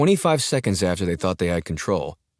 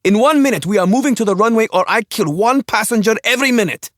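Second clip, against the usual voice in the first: sharp high scream; frantic scream at the pilot; 9 dB louder than usual. Acted. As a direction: bark the destination as a scream. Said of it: "Jelak screamed every word".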